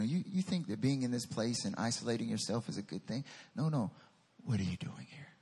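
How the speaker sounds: low, hushed rebuke; quiet confrontation. Acted. God challenges Elijah's isolation, low and soft. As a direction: press softly, with the voice dropped low. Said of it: "God looks at him and says".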